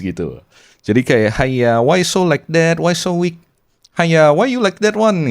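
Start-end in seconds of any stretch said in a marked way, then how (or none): 1.4-5.3 high Malaysian Chinese English, higher pitch and slower; mock scolding in a Singlish accent, high and sing-song; Uncle Roger Singlish impression. Podcaster mocking a weak person in a Singlish accent. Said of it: "roasting orang kayak gaya-gaya Uncle Roger".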